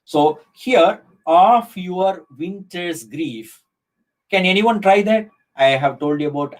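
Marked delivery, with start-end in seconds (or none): 1.2-3.6 slow, measured scansion of a line; scanned verse line. Shown as a demonstration. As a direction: stretch OF, WINTER'S and GRIEF to show the rhythm. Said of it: "What is the rhythm".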